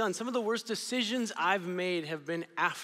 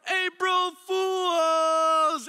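high, loud, drawn-out yell; dad yelling; 11 semitones higher, 7 dB louder, much slower than usual. Retold or imitated. Dad pranking his son, loud drawn-out yell. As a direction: stretch it out like a gleeful prankster. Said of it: "my dad yells".

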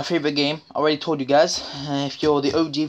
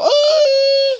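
very high loud squawk; loud mock chicken scream; chicken squawk imitation; 7 dB louder than usual. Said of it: "chickens that go".

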